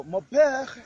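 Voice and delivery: mon père lifted high, slow; emphatic mon père. Shown as a demonstration. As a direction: stress mon père clearly. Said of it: "stands for my father".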